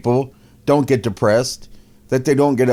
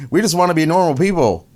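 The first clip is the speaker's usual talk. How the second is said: higher, hammed-up whiny wife; whiny mock victim; 6 semitones higher than usual. Wife complaining to the host in a high mock whine. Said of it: "That's what she says".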